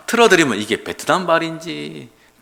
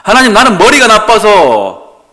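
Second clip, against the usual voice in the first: loud, higher whining excuse; pleading excuse; 9 semitones higher, 14 dB louder than usual. Retold or imitated. Moses whines to God, loud and high. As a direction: complain that you can't. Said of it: "이게 제 간증이거든요".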